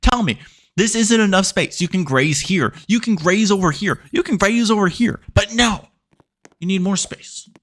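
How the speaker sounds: arguing with a cow that can't hear; frustrated. Real, not acted. Frustrated player arguing with the cow about space. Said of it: "I'm just frustrated".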